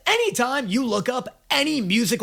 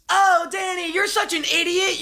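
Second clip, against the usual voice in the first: mock scolding; 8 semitones higher than usual. Mock scolding self, imagined voice.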